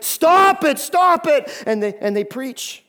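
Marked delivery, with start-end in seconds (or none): 0.0-1.5 loud, high "Stop it" shouted twice; stopping the crowd with a high loud yell; shouted command. Shown as a demonstration. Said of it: "It's like, like".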